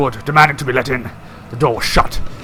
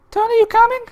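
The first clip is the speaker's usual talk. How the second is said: put-on very high child call; high girlish call; little girl voice; 3 dB louder than usual. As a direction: call like a small sister. Sister calls.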